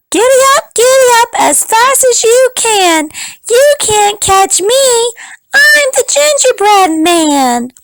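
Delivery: high, bold, teasing chant; high taunting cookie voice. Acted. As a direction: taunt them in a tiny high voice. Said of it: "and said".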